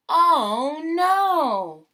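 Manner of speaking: high, loud dismayed cry; excited. Acted. Reader reacting to Pete's mishap for children, excited cry. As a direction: sell the shock for the kids. Storybook disaster moment.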